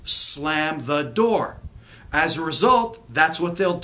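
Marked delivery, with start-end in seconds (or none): 0.1-1.6 very slow slam the door; drawn out as the child hears it; slow echo. Shown as a demonstration. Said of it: "All their nervous system hears is".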